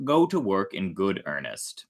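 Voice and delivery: ordinary delivery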